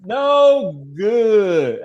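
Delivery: excited call. Real, not acted. Repeats the missed kick call.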